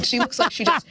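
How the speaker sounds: a light hint of an old president; barely voiced Bush impression; mild presidential impression; George H W Bush voice. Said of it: "That's my George H W Bush voice".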